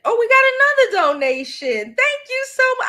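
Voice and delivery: high, loud delighted exclamation; thrilled, jumping up in pitch; excited gratitude. Real, not acted. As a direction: burst out thanking a surprise donor. Spots a new donation mid-plea.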